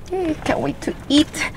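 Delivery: ordinary talk, nothing marked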